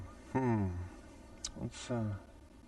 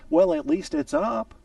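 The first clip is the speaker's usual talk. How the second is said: mocking a defender; 12 semitones higher than usual. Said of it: "How would you feel".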